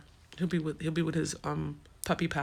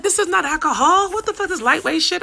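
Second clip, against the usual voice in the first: high, loud retold rant; excited rant; 12 semitones higher, 10 dB louder than usual. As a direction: replay your own fired-up protest. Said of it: "I'm like".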